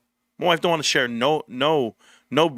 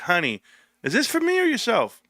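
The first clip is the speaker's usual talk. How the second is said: imitating wife; 7 semitones higher than usual. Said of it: "She'll be like".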